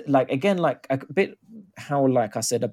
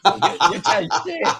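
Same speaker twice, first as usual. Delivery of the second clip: laughing through the words, higher; laughing disbelief; 9 semitones higher, 4 dB louder than usual. Real, not acted. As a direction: laugh as you realise you were fooled. Caught out by fake off-air.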